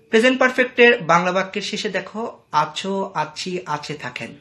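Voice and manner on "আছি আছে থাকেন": ordinary delivery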